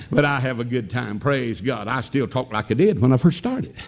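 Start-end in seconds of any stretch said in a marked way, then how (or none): none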